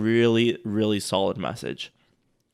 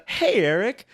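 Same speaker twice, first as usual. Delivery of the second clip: friendly hey; 9 semitones higher, 4 dB louder, slower than usual. Shown as a demonstration. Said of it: "what goes in my mind".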